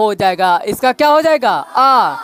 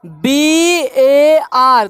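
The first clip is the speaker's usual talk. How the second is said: letters spelled out very slowly; slow spelling; much slower than usual. Shown as a demonstration. Teacher spelling a word to pupils, very slowly. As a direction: spell each letter for the class. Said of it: "तो देखो".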